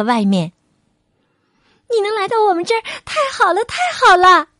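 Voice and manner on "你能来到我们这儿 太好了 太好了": high, bright, louder on the last cry; overjoyed to see a friend arrive; very happy. A narrator's reading. Country mouse welcoming city mouse, high excited greeting. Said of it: "十分高兴地说道".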